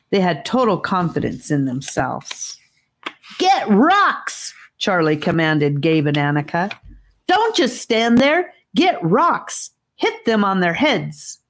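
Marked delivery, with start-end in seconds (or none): 3.4-4.5 frantic command pitched far higher; panicked boy yelling orders, voice way up; desperate high shout to the other children; high-pitched frenzy. Acted. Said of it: "His voice was in a high-pitched frenzy". 7.3-11.4 frantic command pitched far higher; panicked boy yelling orders, voice way up; desperate high shout to the other children; high-pitched frenzy. Acted. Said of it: "His voice was in a high-pitched frenzy".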